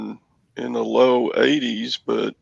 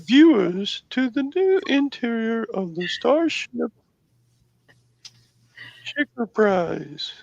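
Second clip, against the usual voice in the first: automated voice; 8 semitones higher than usual. Acted. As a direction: draw it out like a robot. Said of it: "automated voice".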